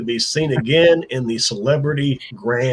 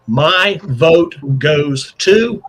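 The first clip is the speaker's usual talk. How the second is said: slow, drawn-out reveal; suspenseful pause; much slower than usual. Real, not acted. Host reading a judge's vote, slow and teasing. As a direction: stretch it out to build the suspense.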